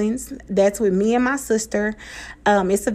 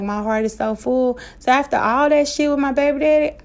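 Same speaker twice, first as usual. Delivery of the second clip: ordinary delivery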